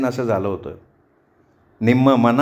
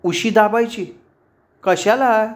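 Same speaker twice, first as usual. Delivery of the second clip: high-pitched baffled questions; puzzled; 7 semitones higher, slower than usual. A narrator's reading. Roommates questioning the neighbour's advice, puzzled. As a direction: echo it back high, bewildered. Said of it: "दोघी गोंधळून पाहत राहिल्या".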